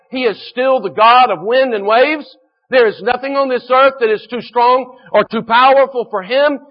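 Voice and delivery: preacher declaring God rules the storms; full-force preaching. Real, not acted. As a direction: proclaim it to the congregation.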